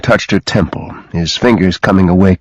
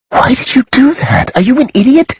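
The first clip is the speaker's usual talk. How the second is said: much higher, frantic yell. A narrator's reading. Woman yells at Garrett for taking the bullet. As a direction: scold him at the top of her voice. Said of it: "she yelled".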